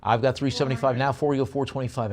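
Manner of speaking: brisk auction chant, pushing for bids; auctioneer bid call. Real, not acted.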